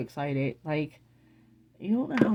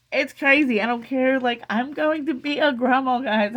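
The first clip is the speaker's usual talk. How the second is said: higher, louder burst of joy; real excitement; 7 semitones higher, 6 dB louder than usual. Real, not acted. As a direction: proclaim it to everyone, overjoyed. Overjoyed about becoming a grandma.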